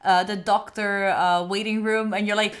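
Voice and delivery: ordinary talk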